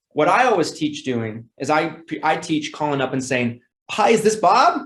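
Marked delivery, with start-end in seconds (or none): 3.9-4.8 bright, high, eager phone hello; enthusiastic greeting. Shown as a demonstration. Salesman opening a call to Bob, high and enthusiastic. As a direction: sound thrilled to reach him. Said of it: "starting out the conversation with a high tone".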